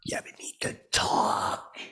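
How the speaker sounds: slow unvoiced whisper; slow conspiratorial whisper. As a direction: lean in and say it secretly. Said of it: "I do like that".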